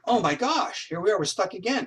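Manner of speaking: high-pitched retold dismay; acted exasperation. Thinking to self as the case stalls, high exasperated dismay. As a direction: voice jumps up in exasperated thought. Own dismayed thought at setback.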